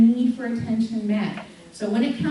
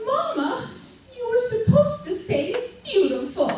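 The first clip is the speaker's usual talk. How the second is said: put-on much higher laughing child's voice; daughter's voice; 12 semitones higher than usual. Daughter telling her mother what she should have said, high and laughing. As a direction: play the disappointed little girl, laughing. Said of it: "she's like".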